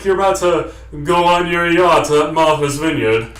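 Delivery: a hint of a snobbish Martha's Vineyard aristocrat; mock aristocrat. Shown as a demonstration. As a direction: lightly play a snooty yacht-club type. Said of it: "so high that it's like".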